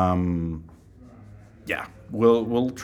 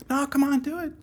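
very high wheedling voice; pestering fan. Pretend. Random fan pressing for the voice, high and wheedling. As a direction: wheedle him in a squeaky high pitch. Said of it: "random folks coming up to you".

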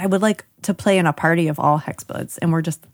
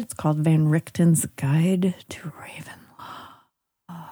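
slow, spooky whisper; creepy whisper; 3 semitones lower, 4 dB quieter, slower than usual. Acted. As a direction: whisper it like a ghost story. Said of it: "This is my creepy voice".

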